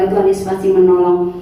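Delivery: normal talking voice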